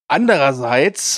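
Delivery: ordinary talk, nothing marked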